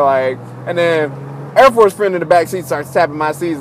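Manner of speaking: usual speaking voice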